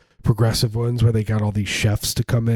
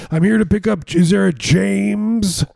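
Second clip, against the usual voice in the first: higher, slow chirpy inquiry; high mock voice; 10 semitones higher, 4 dB louder, slower than usual. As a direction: ask it sweetly, drawn out. Voicing himself at old folks home.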